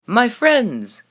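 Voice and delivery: a showman's greeting, pitched high; clear, keen call to the crowd; clear keen announcement. A narrator's reading. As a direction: hail the crowd like a travelling salesman. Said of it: "he announced in a voice clear and keen".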